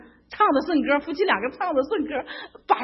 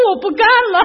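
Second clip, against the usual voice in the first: high, loud refusal with a laugh in it; loud protest; 9 semitones higher, 11 dB louder, faster than usual. Retold or imitated. Retelling quitting the shrimp-peeling job, loud laughing protest. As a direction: blurt out that you quit, half laughing.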